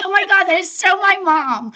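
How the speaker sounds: high, loud exclaim; laughing realization about her mom; delighted recognition. Retold or imitated. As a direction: burst out in amused recognition. Recognizing mom's stubbornness.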